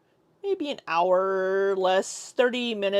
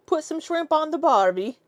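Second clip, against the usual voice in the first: higher, hammed-up Aussie accent; horrible Australian accent; 7 semitones higher, 3 dB louder than usual. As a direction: attempt a bad Australian accent, pitched up. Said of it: "that was horrible".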